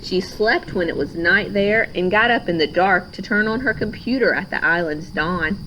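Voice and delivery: ordinary delivery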